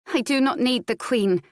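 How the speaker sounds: lightly voiced girl cutting into adult talk; child speaking up. A narrator's reading. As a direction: pipe up as a self-reliant child. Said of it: "Adair suddenly spoke up".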